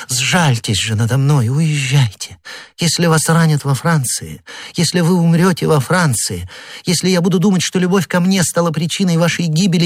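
high, desperate imploring; pleading queen. A narrator's reading. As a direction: beg him to flee, near tears. Anne begs the duke to leave. (королева умоляет герцога уехать)

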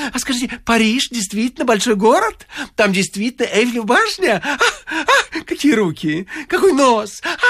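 high, fluttering compliments; gushing flatterer. A narrator's reading. The wit raving over the suburban girl, high and gushing. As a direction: pour on the flattery, breathless with delight.

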